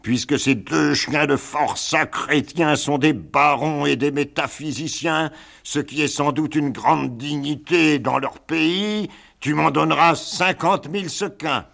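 galley master. Acted. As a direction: sneer out the price. Galley master names his price.

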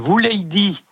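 lightly voiced cross old Provençal man; irritated old Provençal man. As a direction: mimic a grumpy villager repeating himself. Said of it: "d'un monsieur qui s'énervait un peu".

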